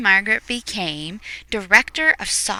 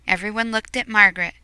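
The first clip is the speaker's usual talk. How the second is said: usual speaking voice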